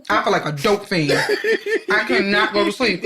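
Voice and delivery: usual speaking voice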